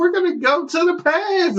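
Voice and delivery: very high, sing-out helper voice. Retold or imitated. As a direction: imitate an over-eager clerk calling out sky-high. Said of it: "And she goes".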